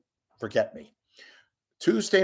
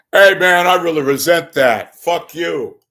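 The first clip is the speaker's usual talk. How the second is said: much louder and higher, indignant blowup; hammed-up indignant outburst; mock angry retort; 8 semitones higher, 13 dB louder than usual. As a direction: Richard Gere snapping at the interviewer, much louder. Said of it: "Now, he didn't say that, but he should have".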